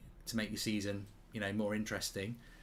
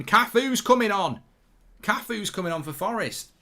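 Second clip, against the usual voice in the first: excited shout; 10 semitones higher, 11 dB louder than usual. Real, not acted. Streamer shouts in shock as Cafu comes on. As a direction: bellow the name in shock. Surprised at substitution news.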